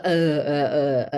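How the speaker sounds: low, muffled "uh" in place of a clean ah; repeated low "uh", off-target vowel; distorted vowel. Shown as a demonstration. Showing parents a vowel distortion, low distorted vowel. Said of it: "itself sounds very different".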